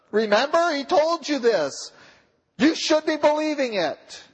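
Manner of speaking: high, eager angel's rebuke; excited angel. Acted. Angels at the empty tomb rebuke the women, high and eager. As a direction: cry out as a joyful angel.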